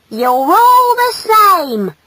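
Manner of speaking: slow, high, loud complaint; high-pitched indignant girl; angry female. Acted. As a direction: scold with drawn-out exasperation. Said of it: "cried the female angrily".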